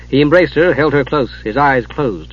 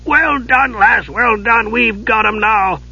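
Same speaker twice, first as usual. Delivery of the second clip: hearty dwarf; 9 semitones higher, slower than usual. Acted. Dwarf praising the girl.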